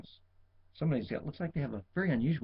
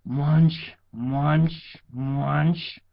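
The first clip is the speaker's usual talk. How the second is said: louder mock munching sheep; chewing sheep; 7 dB louder, much slower than usual. A narrator's reading. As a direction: chomp the words like a sheep. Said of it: "answered the sheep".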